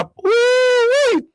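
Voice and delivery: loud, high, drawn-out woo-wee; crash exclamation. Retold or imitated. Storyteller recalling the crash, loud drawn-out whoop.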